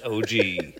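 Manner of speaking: normal talking voice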